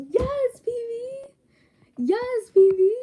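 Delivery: high, loud cheering yes; excited cheer. Real, not acted. To the viewer Valente, cheering high and loud. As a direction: hype up the viewer loudly.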